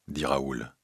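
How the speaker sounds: ordinary delivery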